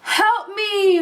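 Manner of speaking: high, strained giantess crying out; a desperate huge woman calls for help; giantess roar. A narrator's reading. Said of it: "the huge woman roared".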